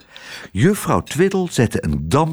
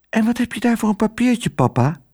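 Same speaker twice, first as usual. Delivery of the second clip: put-on high, quick child voice; little girl's voice; 11 semitones higher than usual. A narrator's reading. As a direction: pipe up like a curious daughter. Child Otje questions her father. (hoge, nieuwsgierige kinderstem)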